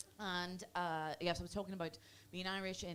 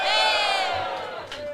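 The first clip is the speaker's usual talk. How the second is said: loud high whoop; shout; 13 semitones higher, 14 dB louder than usual. Real, not acted. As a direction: yell to pump the room. Hyping the crowd.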